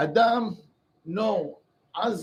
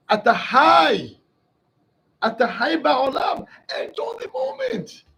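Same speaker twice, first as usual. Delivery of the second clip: preaching shout; 6 semitones higher, 6 dB louder than usual. Acted. Rabbi urging listeners to live fully, shouting. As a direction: call it out like a rallying cry.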